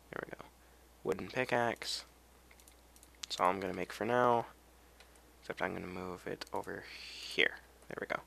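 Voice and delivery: ordinary delivery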